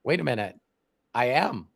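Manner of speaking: ordinary talk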